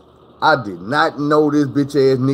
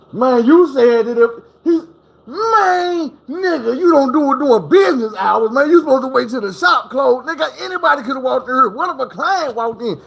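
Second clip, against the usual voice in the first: heated scolding; 11 semitones higher than usual. Retold or imitated. Barber scolds him mid-act.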